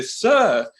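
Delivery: hammed-up British hotel clerk; awful London accent. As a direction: scold in a badly faked London accent. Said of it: "this is a very awful accent".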